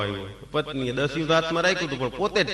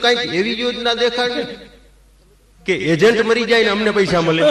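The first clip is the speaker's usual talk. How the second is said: punchline retort; 7 semitones higher, 6 dB louder than usual. Retold or imitated. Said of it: "એટલે મેં કીધું".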